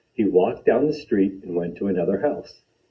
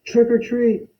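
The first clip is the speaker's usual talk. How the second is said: higher and louder call; a little louder. Acted. Boy calling out trick or treat a little louder. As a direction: raise the voice a notch. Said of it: "a little louder".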